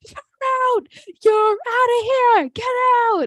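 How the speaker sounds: high, loud imitation of a screaming coach; screaming in his face. Coach ejecting a player from the team, imitated scream. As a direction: yell it like a coach throwing a player out. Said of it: "Like, screaming in his face".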